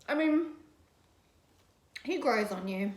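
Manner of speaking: usual speaking voice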